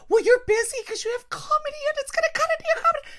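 very high, fast, put-on mom voice; squeaky rushed mom voice; mom's excuse. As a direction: rattle off excuses like a fussy mother.

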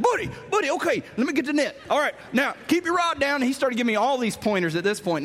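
high excited coaching; frantic dad barking pointers; dad freaking out. Retold or imitated. Dad freaking out coaching son to land bass, excited.

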